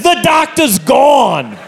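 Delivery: high, drawn-out exasperated holler; mock shout. Assistant answering the patient's plea, exasperated mock shout. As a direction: shut the request down with a long high cry.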